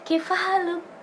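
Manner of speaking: Arabic greeting. Retold or imitated.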